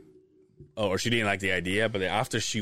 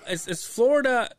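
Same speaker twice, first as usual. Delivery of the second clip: excited; 11 semitones higher, 5 dB louder than usual. Real, not acted. Mock excited guess.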